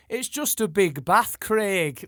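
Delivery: loud, high, drawn-out mock-Geordie punchline; imitated Geordie accent. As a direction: shout the punchline slowly in put-on Geordie. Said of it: "Like that".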